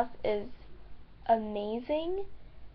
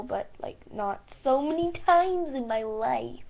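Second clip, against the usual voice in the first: ordinary delivery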